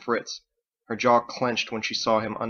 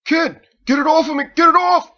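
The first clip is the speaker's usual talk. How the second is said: much higher and louder, desperate; panicked shout; 13 dB louder than usual. Acted. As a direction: scream for help while pinned. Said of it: "struggling and shouting".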